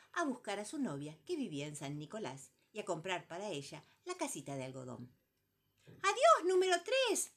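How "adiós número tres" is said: loud, high chirpy bird call; shouted bird greeting. Acted. Calandria calling out loudly to number three. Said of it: "le gritó una calandria".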